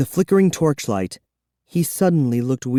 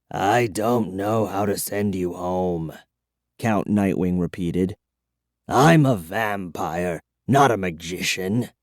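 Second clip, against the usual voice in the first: put-on weary old vampire; 5 semitones lower, 4 dB quieter than usual. A narrator's reading. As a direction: confess it tiredly. Vampire explains.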